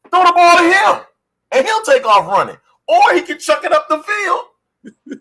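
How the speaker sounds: high-pitched, fired-up pitch; real excitement. Real, not acted. Football host pitching a trick play, excited. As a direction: get carried away selling the play.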